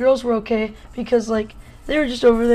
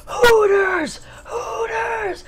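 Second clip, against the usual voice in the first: imitated crowd scream; 11 semitones higher, much slower than usual. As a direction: yell it like a bus full of rowdy kids. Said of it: "everyone just screams".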